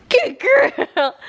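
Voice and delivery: sing-song pet voice, way up high. Real, not acted. Owner coos goodbye to her dog in high baby talk. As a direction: coo at the dog in a squeaky voice.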